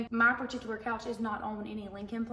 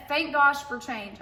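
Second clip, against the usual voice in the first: slow, louder, gosh stressed; emphatic relief; 3 semitones higher, 6 dB louder, slower than usual. Real, not acted. Relieved aside after cringing.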